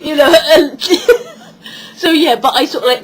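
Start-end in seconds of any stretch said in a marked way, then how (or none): 0.8-1.3 squeaky high laugh; laughing at the memory; high burst of laughter. Real, not acted. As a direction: let a giggle burst out. Laughing at son's swearing.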